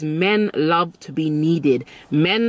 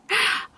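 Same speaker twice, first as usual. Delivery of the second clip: mock delighted gasp. Shown as a demonstration.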